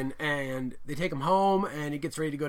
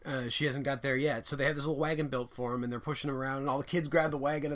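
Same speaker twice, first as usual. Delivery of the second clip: normal talking voice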